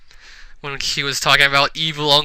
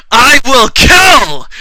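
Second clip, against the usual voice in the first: high, loud, drawn-out mock threat; hammy villain threat; 9 semitones higher, 8 dB louder, much slower than usual. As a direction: stretch it into a villainous yell. Villain threatens after laughing.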